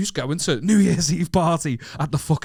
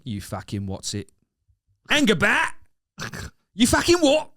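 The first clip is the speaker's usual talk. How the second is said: a lightly done Cockney accent, climbing high; 5 semitones higher than usual. Shown as a demonstration. Doing Cockney for his co-host, lightly done, rising high. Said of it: "I can do Cockney".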